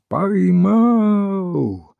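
high, long drawn-out call; high ringing cry of capture; triumphant cry. A narrator's reading. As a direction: call it out across a yard, stretching the vowel. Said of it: "огласил дворик криком".

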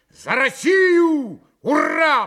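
loud, high, drawn-out hurrah; провозглашая тост. A narrator's reading. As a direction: proclaim it to the whole company. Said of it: "провозгласил он снова". (громко провозглашая, ликуя)

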